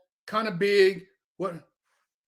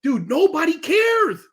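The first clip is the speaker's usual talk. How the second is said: exasperated shout; 8 semitones higher than usual. Retold or imitated. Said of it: "I'm like".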